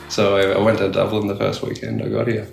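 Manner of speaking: ordinary delivery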